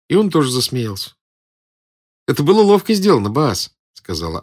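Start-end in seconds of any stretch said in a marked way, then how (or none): 2.3-3.8 high, quick character voice; girl's impressed exclamation; admiring girl. A narrator's reading. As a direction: praise the conjurer in a lifted, lively voice. Girl praises the conjurer.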